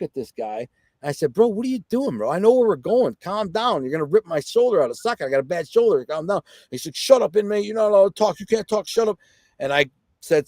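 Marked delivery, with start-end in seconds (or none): 1.3-6.4 higher-pitched, exasperated pleading; agitated protest. Retold or imitated. Inmate to guard yanking his bad shoulder, agitated protest. 6.9-9.2 high-pitched mock guard order; bossy guard. Guard ordering inmate to be silent, bossy mock command.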